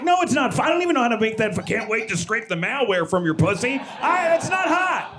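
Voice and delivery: high-pitched, rapid mock protest; acted incredulous rant; exasperated denial. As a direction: pitch it up in comic indignation. Acted exasperated answer to wife.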